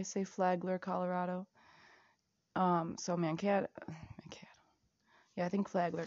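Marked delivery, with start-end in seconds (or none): 3.9-4.5 whispered. Real, not acted. Repeating a place name, then whispered.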